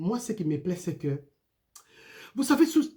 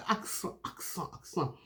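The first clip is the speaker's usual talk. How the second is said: very soft, nearly voiceless, after a chuckle; playful hushed repetition; 3 semitones lower, 11 dB quieter than usual. Pretend. Preacher puzzling aloud over accents, amused and hushed. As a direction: toy with the word under your breath.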